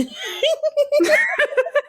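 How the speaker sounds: long, high-pitched cackling laugh; high, squealing fit of laughter; real laughter. Real, not acted. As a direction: crack up at your own joke. Cracking up at own joke.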